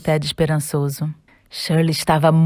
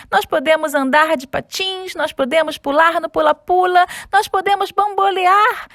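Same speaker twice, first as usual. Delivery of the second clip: excited; 11 semitones higher than usual. Acted. Found his pair, overjoyed.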